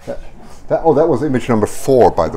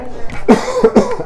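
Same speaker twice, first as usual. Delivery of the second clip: sharp dry cough; real cough. Real, not acted. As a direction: cough into the mic. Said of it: "I'm coughing a bit".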